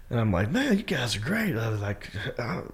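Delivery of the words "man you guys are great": high, soft mock gushing; retold crowd-pleasing. Comic tells his crowd they're great, lightly gushing. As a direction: replay the delight without projecting.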